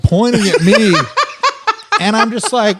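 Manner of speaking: ordinary delivery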